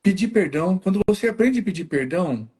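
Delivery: ordinary delivery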